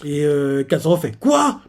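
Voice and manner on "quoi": high indignant yelp of disbelief; surprised. Retold or imitated. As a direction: react like you can't believe the nerve. Katsuro disputes the kill.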